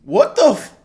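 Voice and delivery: high, loud sudden burst; real startled exclamation; shocked cutoff curse. Real, not acted. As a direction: blurt it out in shock. Stunned by the clip.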